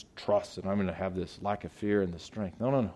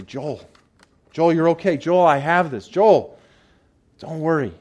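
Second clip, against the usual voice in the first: Lord calling out; 5 semitones higher, 7 dB louder than usual. Acted.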